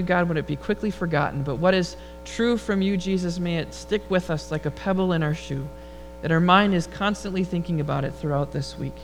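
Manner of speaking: ordinary talk, nothing marked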